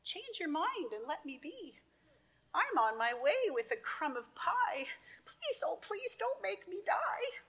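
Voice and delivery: high, pleading little ant; tiny ant voice. Acted. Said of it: "says the ant".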